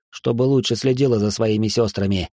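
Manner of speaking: plain reading voice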